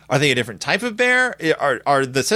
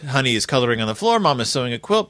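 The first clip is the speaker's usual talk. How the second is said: normal talking voice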